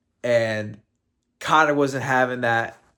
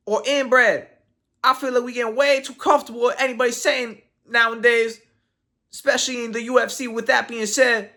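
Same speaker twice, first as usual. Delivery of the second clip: higher, quicker tweet read; Joaquin Buckley's voice; 8 semitones higher than usual. Retold or imitated. Reading a fighter's tweet in his voice, higher and quicker. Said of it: "I'll read this in Joaquin Buckley's voice".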